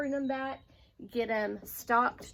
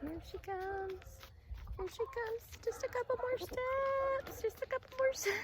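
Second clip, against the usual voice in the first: soft coaxing; 12 semitones higher, 4 dB quieter than usual. Real, not acted. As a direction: hush the hen along step by step. Hen nearly in the coop.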